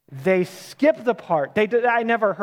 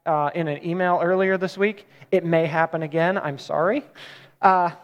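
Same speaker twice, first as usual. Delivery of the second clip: normal talking voice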